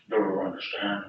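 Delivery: low, soft bartender's warning; hushed, restrained menace; quiet threat. Acted. Bartender's warning said once more.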